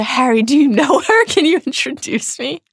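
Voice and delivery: high, eager lovesick voice; lovestruck pleading. A narrator's reading. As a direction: beg like a smitten boy.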